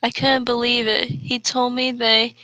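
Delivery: normal talking voice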